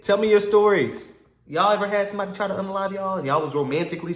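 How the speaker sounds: loud, pumped sign-off; rally the viewers to subscribe; hyped-up YouTuber closing shout-out; hype outro. Acted. Channel outro call to action.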